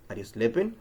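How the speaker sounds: "Are you sleeping?" going up on "sleeping"; rising question tune. Shown as a demonstration. Teacher showing we're asking a question, rise on "sleeping". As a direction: lift the voice at the end of "sleeping". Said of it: "voice goes up at the end".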